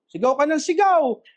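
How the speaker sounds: normal talking voice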